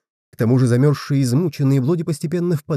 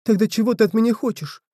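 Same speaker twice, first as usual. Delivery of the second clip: put-on exhausted boy; 8 semitones higher than usual. A narrator's reading. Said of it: "обессиленно спросил Блоди".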